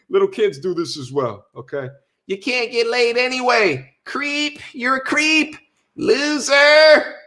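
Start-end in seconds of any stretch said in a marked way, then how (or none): 2.3-7.1 higher, sneering mock of an online troll; mocking insult voice. Streamer mocking trolls who insult men, in a higher sneering voice. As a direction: ham up the name-calling creep, higher.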